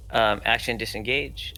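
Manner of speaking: usual speaking voice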